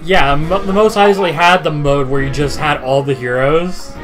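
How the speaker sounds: usual speaking voice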